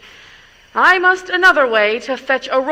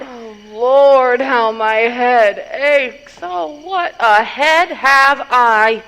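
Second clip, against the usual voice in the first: groaning in pain; 5 dB louder, slower than usual. Acted. Nurse moans about her aches.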